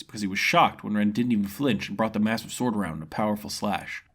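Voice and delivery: ordinary delivery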